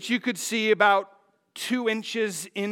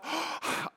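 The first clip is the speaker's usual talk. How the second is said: sharp audible gasp; mock gasp of sudden hope; acted gasp; 8 semitones higher, 10 dB quieter than usual. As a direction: suck in a big breath mid-story. Acts out thought while swimming.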